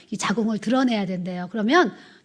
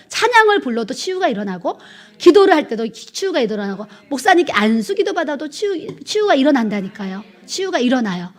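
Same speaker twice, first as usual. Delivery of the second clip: rising, louder preaching push; fervent revival preaching; 5 dB louder than usual. Acted. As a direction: preach it out with growing force. Preaching about healing.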